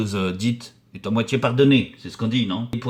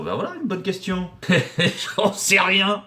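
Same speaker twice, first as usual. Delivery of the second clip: amused laugh; 8 semitones higher than usual. Real, not acted. Caught off guard by a question.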